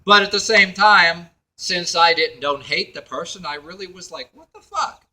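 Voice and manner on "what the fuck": high-pitched incredulous aside; high, mock-baffled question; retold disbelief. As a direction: voice a baffled inner reaction. Voices own inner reaction.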